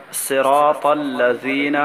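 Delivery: صراط الذین drawn out slowly, each sound separate; slow careful Arabic recitation drill. Shown as a demonstration. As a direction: spell it out slowly for the student. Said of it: "word-by-word drill".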